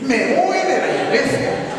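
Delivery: high, loud, slow mocking whine with a laugh; mock whiny complaint. Preacher mocking members who quit the church, whiny and laughing. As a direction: parody a sulky churchgoer.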